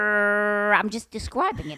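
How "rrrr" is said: rolled mock growl; imitated dog growl. Acting out the aggressive dog for the listener, mock growl. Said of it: "okay, it's going".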